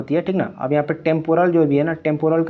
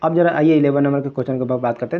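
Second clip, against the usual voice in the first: ordinary delivery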